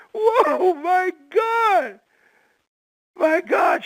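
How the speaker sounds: high, loud astonished cry; amazed exclaim. Retold or imitated. Amazed man reacting to what he sees, high and loud. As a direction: gasp it out in wonder.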